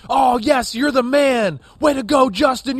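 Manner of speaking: excited cheer. Retold or imitated. Analyst imitating Bears players celebrating Fields, excited shout. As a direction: cheer him on like a hyped teammate.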